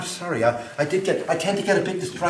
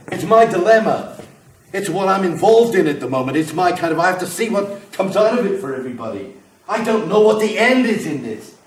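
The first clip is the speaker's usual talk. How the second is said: rising, louder frustrated plea; agitated; 2 semitones higher, 4 dB louder than usual. Acted. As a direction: build from urging to loud exasperation. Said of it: "I'm getting agitated again".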